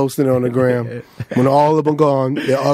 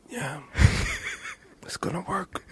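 much softer, near-whispered yes with a stifled laugh; hushed reassurance; 12 dB quieter than usual. Retold or imitated. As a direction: promise it under your breath while holding in laughter. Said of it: "He goes".

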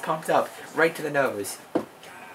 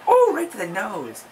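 excited; 9 semitones higher, 4 dB louder than usual. Real, not acted. Excited wrestling commentary.

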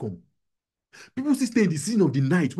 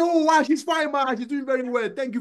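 much higher, playful mock enthusiasm; retold gushing reply; 10 semitones higher than usual. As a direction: act out an eager cover-up. Acting out his bluff at party.

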